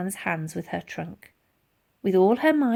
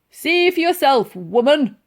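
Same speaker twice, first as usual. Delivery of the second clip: loud high gruff retort; called back; 6 semitones higher, 8 dB louder than usual. Acted. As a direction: bark it back at her. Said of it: "he called back".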